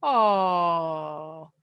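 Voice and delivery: tender, adoring sigh at something cute; cooing over a puppy. Shown as a demonstration. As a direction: coo it like you want to cuddle a puppy. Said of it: "like you just saw a puppy and you wanna cuddle it".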